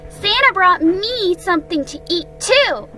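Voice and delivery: high-pitched eager little voice; Roo, eager. Acted. Roo showing his friends the cookies, high and eager. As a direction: pitch it high and pipe up keenly.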